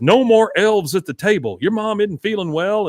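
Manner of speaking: mock scolding father; Hank voice. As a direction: lay down the law lightly. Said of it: "He says".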